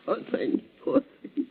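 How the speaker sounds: soft breathy whisper of pity; grieving whisper. Acted. Housekeeper grieving her dead mistress, hushed and unvoiced. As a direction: barely voice it, mourning.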